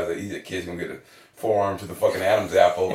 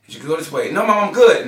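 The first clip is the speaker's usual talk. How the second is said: insisting to mom; 7 semitones higher, 10 dB louder than usual. Retold or imitated. Arguing with mom in car.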